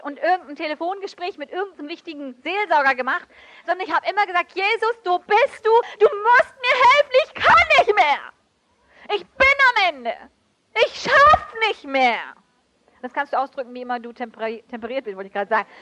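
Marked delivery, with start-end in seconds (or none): 4.5-12.3 high, loud, pleading outcry; desperate cry to God. Retold or imitated. Preacher reliving crying out to Jesus, loud desperate plea. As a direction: cry out at the end of your strength. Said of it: "Ich musste manchmal schreien".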